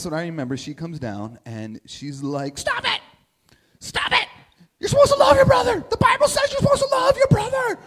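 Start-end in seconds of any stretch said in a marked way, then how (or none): 2.6-7.8 much higher and louder, a frantic mom's scolding shout; mom's frustrated shout. Retold or imitated. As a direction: scream it at the fighting boys. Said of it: "she'd be very frustrated".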